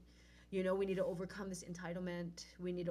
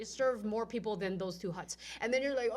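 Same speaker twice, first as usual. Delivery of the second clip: ordinary delivery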